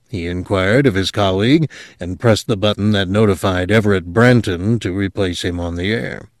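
ordinary delivery, nothing marked

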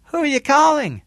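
high, loud call from another room; calling from kitchen. A narrator's reading. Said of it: "Julie said from the kitchen".

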